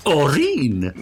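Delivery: Morningside accent. Shown as a demonstration. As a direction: say Oreen the genteel Edinburgh way. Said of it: "if you say that in your Morningside accent".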